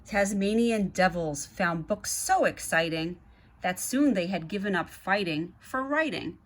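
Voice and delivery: put-on lively read-aloud to children; story narration. A narrator's reading. Reading the Zoobrary book to kids, lively narration. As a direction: read it like a bedtime picture book.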